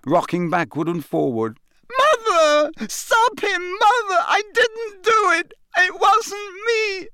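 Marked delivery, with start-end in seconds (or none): 2.0-7.1 very high, crying desperate plea; a boy in terror begging his mother to save him; sobbing scream. A narrator's reading. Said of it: "screamed the boy below".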